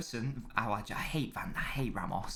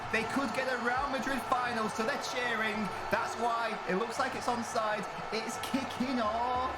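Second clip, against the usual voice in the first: high-pitched live goal commentary; excited play-by-play; 10 semitones higher than usual. Real, not acted. Streamer calling Benzema's goal to viewers, excited high commentary. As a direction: call the goal as it all kicks off, pitch climbing high.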